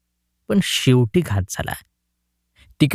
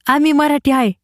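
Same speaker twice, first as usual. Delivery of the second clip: put-on much higher boy's voice; village boy; 12 semitones higher than usual. A narrator's reading. Jagu tells the man they are Marathi, much higher. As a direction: answer the man proudly. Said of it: "जगू म्हणाला".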